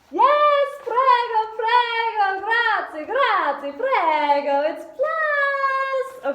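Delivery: very high, loud mock-Italian rejoicing; bad Italian accent. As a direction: cheer wildly in a badly faked Italian accent. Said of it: "I gotta work on my Italian accent".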